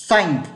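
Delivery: teacher shows Thank with the tongue pushed out; English th sound. Shown as a demonstration. Teacher demonstrating Thank to students, tongue-out th. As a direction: Thank with the tongue between the teeth, a hissing th at the start. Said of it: "lưỡi nó phải đưa ra khỏi miệng".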